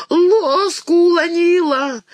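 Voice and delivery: put-on high, slow crying baby talk; toddler wail. A narrator's reading. Toddler wails at Katya for dropping the spoon, high and slow. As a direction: cry it like a wronged toddler. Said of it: "истошно взревела Марьяшка".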